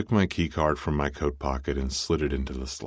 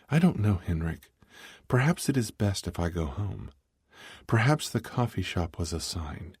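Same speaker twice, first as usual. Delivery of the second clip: higher-pitched voice for a reluctant woman; hesitant young heroine; 5 semitones higher than usual. A narrator's reading. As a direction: voice her unsure, pitched up. Narrator voices Morgana's reluctant reply.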